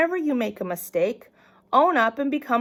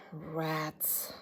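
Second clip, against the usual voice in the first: sighed-out, breathy disappointment; dejected sigh; 6 semitones lower, 16 dB quieter than usual. Acted. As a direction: let it deflate with a sigh. Sighs, knowing she's in trouble.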